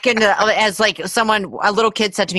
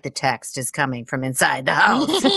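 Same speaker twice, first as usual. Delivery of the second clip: horror-movie line; 8 semitones lower, 5 dB quieter than usual. Acted. Parody of horror-film phone line.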